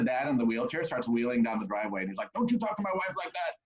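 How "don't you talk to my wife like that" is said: high mock protective father; lightly voiced angry dad. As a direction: warn him off, pitched up. Dad in wheelchair defending wife.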